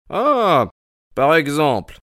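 high acted burst of surprise; astonished exclamation. Holmes hears of unnatural incidents from Mortimer, astonished.

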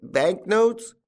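high, questioning whine; bewildered tramp. A narrator's reading. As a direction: echo it as if baffled.

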